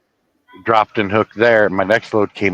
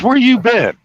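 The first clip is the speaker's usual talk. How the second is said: greeting friend; 13 semitones higher, 3 dB louder than usual. Retold or imitated. Italian friend greeting him, voiced.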